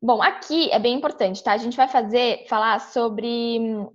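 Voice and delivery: ordinary talk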